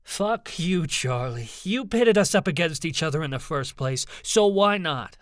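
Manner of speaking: high, rising outburst; angry demand. A narrator's reading. Ivy blames Charlie for the infighting, demanding angrily. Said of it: "Ivy demanded".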